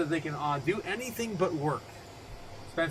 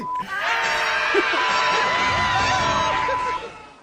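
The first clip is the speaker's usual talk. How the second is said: long laughing shout; loud cackling laugh; 8 dB louder than usual. Real, not acted. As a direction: burst out cackling.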